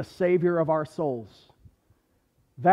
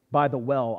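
usual speaking voice

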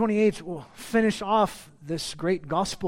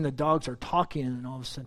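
usual speaking voice

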